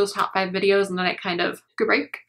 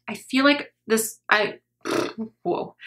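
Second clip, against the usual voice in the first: usual speaking voice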